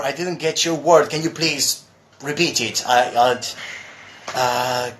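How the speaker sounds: normal talking voice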